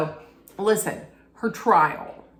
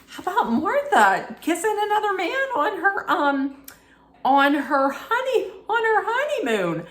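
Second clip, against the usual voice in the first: scandalized; 11 semitones higher than usual. Real, not acted. Telling her listeners about a honeymoon kiss, scandalized. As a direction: gasp it out like juicy gossip.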